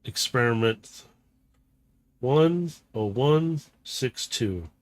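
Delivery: slow, detached robotic voice; cold scientist announcement. Acted. Villain scientist addressing the captive robot, slow and detached. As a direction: announce the test number like a lab log.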